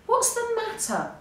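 high-pitched, irritated demand; mildly cross storybook question; angry. A narrator's reading. Woken Pig answers the door, angry. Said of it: "asked Pig angrily".

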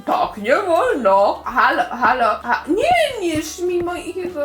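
very low, slow creature voice; transformed monster voice. Pretend. Changed muffin guarding her little house, slow low monster. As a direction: drag the words out low and heavy.

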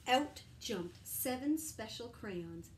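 usual speaking voice

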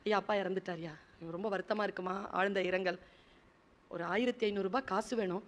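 low, hushed acted plea; subdued plea. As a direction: ask softly and humbly, as if grieving. Bereaved young writer asks for money. (துயரத்தோடு தாழ்ந்த குரலில் கெஞ்சல்)

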